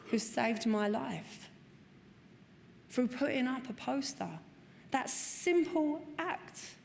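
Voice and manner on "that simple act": "simple" lifted high and drawn out slowly; slow emphasis. Real, not acted. Marvelling at the poster that saved her, slow emphatic stress. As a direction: dwell on "That simple act" in awe.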